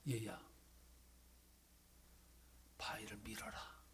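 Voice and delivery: low, hushed voice of God; God's voice. Acted. God answering the sick man, low and hushed. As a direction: speak as God, low and close.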